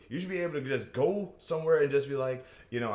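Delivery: normal talking voice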